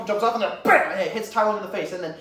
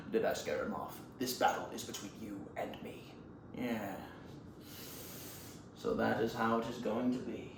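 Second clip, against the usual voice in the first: very low, hushed voice; almost whispered, deep and restrained; quiet standoff; 8 semitones lower, 12 dB quieter than usual. Acted. Master and former pupil before their battle, hushed menace.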